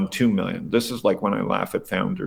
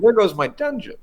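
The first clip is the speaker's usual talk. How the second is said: high, louder mock quote; mimicking Pincus; 12 semitones higher, 4 dB louder than usual. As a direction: toss it off in a higher voice. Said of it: "He goes".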